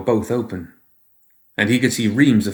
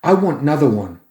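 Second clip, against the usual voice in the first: put-on demanding child; 6 semitones higher, 3 dB louder than usual. Boy demands more.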